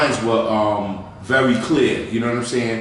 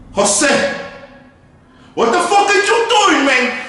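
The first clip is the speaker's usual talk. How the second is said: yelled high and loud in outrage; furious high shout; 4 dB louder, slower than usual. Retold or imitated. Uncle bursting into the room at Jose, a high furious shout. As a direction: catch the kids red-handed.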